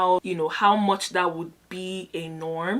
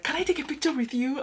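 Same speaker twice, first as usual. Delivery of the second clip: eager, higher-pitched fan asking; excited request; 7 semitones higher than usual. Retold or imitated. Excited traveller asking a random lady for a picture, eagerly. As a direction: re-enact an excited ask, voice lifted. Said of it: "I was so excited".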